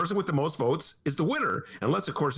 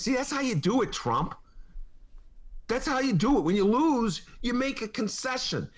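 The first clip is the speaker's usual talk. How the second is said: sarcastic taunt; 8 semitones higher than usual. Acted.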